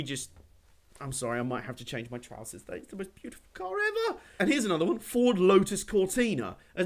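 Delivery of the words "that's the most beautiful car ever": much higher, soft swoon; breathless high-pitched adoration; awestruck. Real, not acted. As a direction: gush over the car like a crush. Swooning over a classic car.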